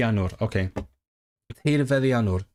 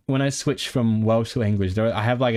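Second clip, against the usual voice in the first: usual speaking voice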